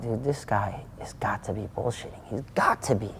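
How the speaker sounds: near-whispered, low aside; hushed private thought. Retold or imitated. Storyteller confiding his private doubt about the dealer, hushed. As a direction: mutter your suspicion under your breath.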